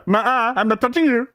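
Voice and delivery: high, whiny schoolyard taunt; bratty kid voice. Retold or imitated. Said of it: "vibe into this".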